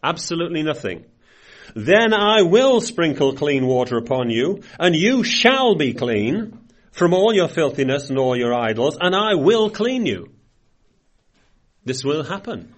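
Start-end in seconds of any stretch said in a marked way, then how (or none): 1.7-10.3 louder, proclaiming Bible read; scripture reading. Acted. Preacher proclaiming God's promise to the congregation, louder reading.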